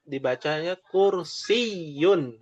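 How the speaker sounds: kursiyun said slowly with a doubled y. Shown as a demonstration. Teacher shows the shadda in kursiyun. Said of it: "Ya-nya ada tasydidnya".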